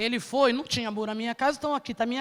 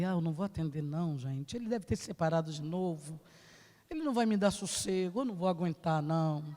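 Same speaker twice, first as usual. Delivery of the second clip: hushed to herself; 10 semitones lower, 8 dB quieter than usual. Retold or imitated. Hiding from caller, speaking quietly.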